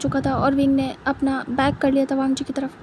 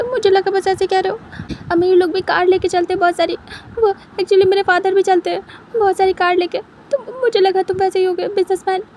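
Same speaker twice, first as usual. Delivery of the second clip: higher, louder, near tears, pouring out excuses; tearful panicked pleading; 5 semitones higher, 4 dB louder than usual. Acted. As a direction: babble out apologies between sobs. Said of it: "wing चिल्ला रहा था".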